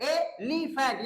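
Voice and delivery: एलिफेंट slowly in three beats, ए-ली-फेंट; three separate syllables. Shown as a demonstration. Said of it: "यानी एक, दो, तीन".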